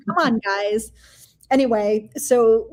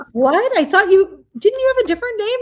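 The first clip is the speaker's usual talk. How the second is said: much higher, fast, stunned questions; retold astonishment; 10 semitones higher, 5 dB louder than usual. Friend stunned to learn Susie makes her syrup, much higher and fast. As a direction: play the friend's stunned disbelief.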